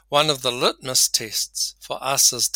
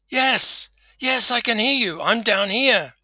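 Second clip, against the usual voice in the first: high, loud shout back; excited shouting; 11 semitones higher, 7 dB louder than usual. Acted. Stranded man answers the voice above him, shouting excitedly. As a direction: call up eagerly to a would-be rescuer.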